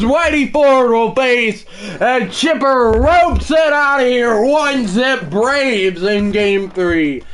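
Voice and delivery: excited sportscaster voice; play-by-play. Acted. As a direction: call the home run like a broadcaster. Play-by-play of the inning.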